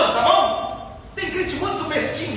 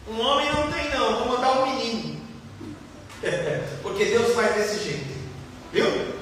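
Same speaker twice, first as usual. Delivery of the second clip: ordinary talk, nothing marked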